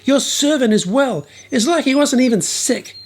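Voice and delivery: mock soldiers running up with good news; excited yelling. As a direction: soldiers calling to their master, high and excited.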